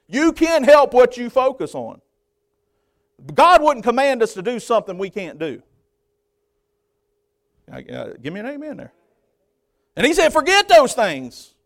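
high, loud bursts with pauses between; full-force preaching. Real, not acted. Preacher telling church they choose their focus, full-force preaching. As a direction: punch out each command, then leave space.

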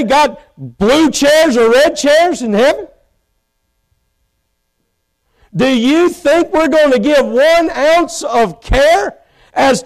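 revival-style rhetorical build; full-force preaching. Acted. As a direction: hammer the questions home from the pulpit. Preaching, mocking petty worries.

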